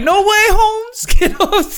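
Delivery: put-on high, slow Chicano accent, laughing through it. Retelling a meme scene in a mock Chicano accent. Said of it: "Adrian's asked me not to do the accent".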